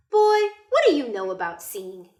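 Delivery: loud grandmother rebuke; firm grandmother voice; Nana scolding. Acted. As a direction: chide the boy, loud. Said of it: "Nana told him".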